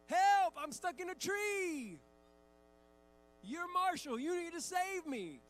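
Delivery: high, loud mock kid yell; child's urgent pleading. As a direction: holler like a little boy demanding rescue, stretching the endings. Son demands rescue in game.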